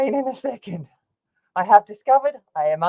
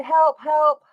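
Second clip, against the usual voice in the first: retold cry for help; 5 semitones higher, much slower than usual. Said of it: "that sounds like real distress".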